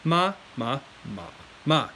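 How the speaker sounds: four "ma"s, each with its own tone; four tones in order. Shown as a demonstration. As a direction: demonstrate all four tones on "ma". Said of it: "So once again".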